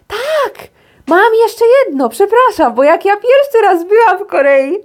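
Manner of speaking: high-pitched burst of remembering; real excitement, voice jumping up; sudden excited recall. Real, not acted. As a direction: blurt it out, excited, as the memory hits. Suddenly remembers another story of hers.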